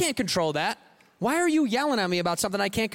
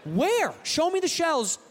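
demanding; 9 semitones higher, slower than usual. Acted. Repeated rant demand.

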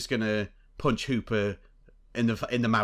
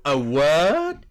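loud, higher rising question; mock disbelief; 4 semitones higher, 7 dB louder than usual. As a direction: blurt it as an affronted catchphrase. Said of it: "just go".

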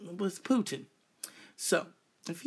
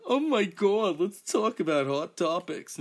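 loud, high mock talk-show host; Wendy Williams; 5 semitones higher, 7 dB louder than usual. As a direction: gush like a hammed-up gossip host. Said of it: "I'm not Wendy Williams".

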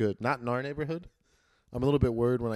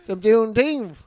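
high voice; 10 semitones higher, 8 dB louder, slower than usual. Pretend. Continuing the put-on voice bit.

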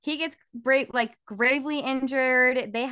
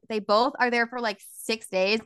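normal talking voice

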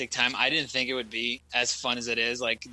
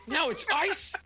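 put-on high whiny old-man grumble; whiny complaint. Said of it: "I was like".

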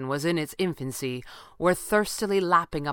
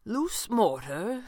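slow, higher, questioning echo; puzzled; 5 semitones higher, 3 dB quieter, much slower than usual. A narrator's reading. Falcone echoing her answer, puzzled. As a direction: repeat it slowly as a baffled question. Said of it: "Falcone asked, puzzled".

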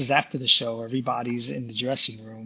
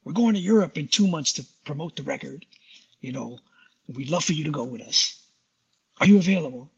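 [name] voice; 4 semitones higher than usual. Retold or imitated. [name] inviting Mark to tour Europe, booming. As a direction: boom down the phone like the drummer. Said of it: "I'm gonna do it in the voice of [name]".

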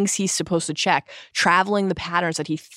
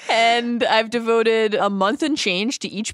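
mock baffled writer; 7 semitones higher than usual. Mocking the author's confession.

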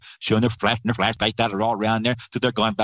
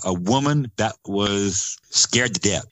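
ordinary talk

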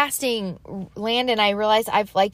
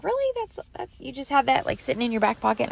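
mock disbelief jumping very high on "Really"; playfully stunned, pitch leaping up then settling; incredulous; 5 semitones higher, 4 dB quieter, faster than usual. Imitating her amazed reply to her husband, very high and incredulous. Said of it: "And I'm like".

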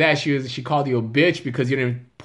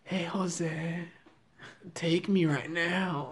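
hushed, higher, drawn-out come-on; slow, soft mock-flamboyant seduction; put-on flamboyant voice; 4 semitones higher, 9 dB quieter, much slower than usual. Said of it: "let me hear you flamboyant in English".

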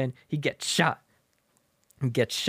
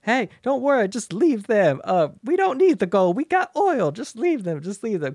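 high, louder hammed-up old prospector; 8 semitones higher, 5 dB louder than usual. The prospector tells Woody to let the bandits go, high and eager. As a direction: urge them on like an excited old miner. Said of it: "the prospector is like".

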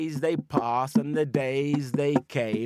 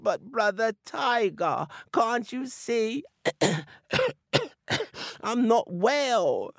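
feigned sick voice; 9 semitones higher than usual. Acted. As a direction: play sick with pitiful coughs. Anansi faking illness to Tiger.

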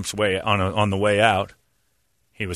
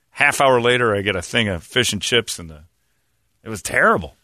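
usual speaking voice

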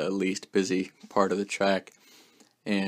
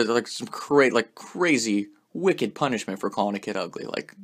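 usual speaking voice

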